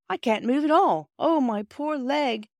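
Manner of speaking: high, drawn-out groan. A narrator's reading. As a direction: groan in play-acted agony. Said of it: "he groaned again".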